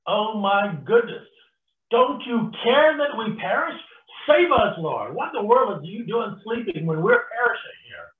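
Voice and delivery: loud, high frantic pleading; panicked disciples. Acted. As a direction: cry out in panic to be saved. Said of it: "They are panicking".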